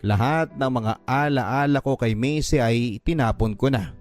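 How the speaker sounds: ordinary talk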